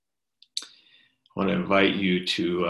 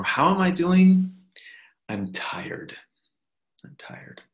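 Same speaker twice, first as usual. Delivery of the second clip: weary congregant; 8 semitones higher than usual. Pretend. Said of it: "lead us all to say".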